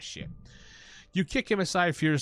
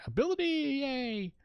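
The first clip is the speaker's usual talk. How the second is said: game cheer voice; 13 semitones higher, slower than usual. Acted.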